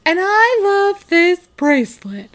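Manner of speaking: high, lightly put-on Samantha; hammy gushing Samantha; mock Samantha. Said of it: "And she goes".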